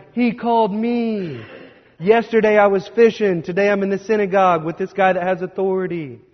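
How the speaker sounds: high, eager boasting; bragging. Retold or imitated. Fisherman disciple bragging about being called, high and eager. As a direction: brag to your friends about being chosen. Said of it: "And they're bragging".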